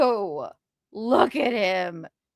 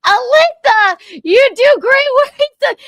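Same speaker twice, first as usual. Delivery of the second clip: much higher and louder, slowed on the praise; excited praise; 11 dB louder, slower than usual. Real, not acted. Host praising editor Alyssa for the clip, excited shout. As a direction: cheer her on like a delighted fan.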